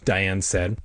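plain reading voice

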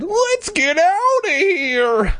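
very high, drawn-out wimpy whine; whiny little coward. Acted. Scared boy begs sister to leave, wimpy whine. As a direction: stretch it out like a cowardly kid. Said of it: "I said like a little bitch".

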